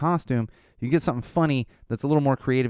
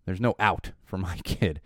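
ordinary talk, nothing marked

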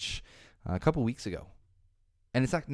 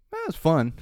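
higher, brighter quote of a pal; mock cheerful friend; 7 semitones higher, 4 dB louder than usual. Podcaster quotes his Marvel fan friend, chirpy. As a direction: toss it off happily like the friend.

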